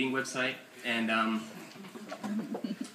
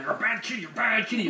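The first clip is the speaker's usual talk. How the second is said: mock scolding scream; 7 semitones higher than usual. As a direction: imitate screaming at a naughty cat. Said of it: "I would scream at him".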